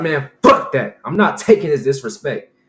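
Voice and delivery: loud, worked-up retelling of anger; retold pissed-off outburst. Storyteller refusing his neighbor's disrespect, loud acted anger. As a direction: burst out, refusing to back down. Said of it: "I started getting pissed".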